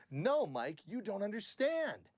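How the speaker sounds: NO punched, UNDERSTAND drawn out high; musical line reading. Shown as a demonstration. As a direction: lift "understand" high and stretch it. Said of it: "Okay, now musically".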